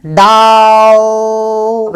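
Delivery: डाउ held extremely long on one level note; long sustained stress on डाउ; stressed syllable. Shown as a demonstration. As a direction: stretch the vowel of डाउ right out. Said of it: "हम stress जो कर रहे हैं".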